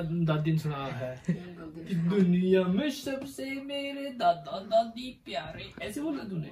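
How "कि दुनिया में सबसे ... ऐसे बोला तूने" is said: put-on much higher, slow sing-song cooing at the baby; baby talk. Father coos to his baby daughter in high sing-song baby talk. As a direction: coo it like you're talking to an infant.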